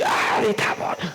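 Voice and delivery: mock groaning put-off; lazy reluctance. Preacher mocks how believers answer God, groaning reluctantly. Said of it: "nos lo ponemos así".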